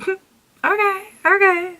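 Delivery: high, drawn-up little "okay"; impressed reaction. Retold or imitated. Vlogger reenacting trying highlighter on her face, impressed and high-pitched. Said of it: "I was just like".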